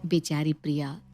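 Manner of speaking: usual speaking voice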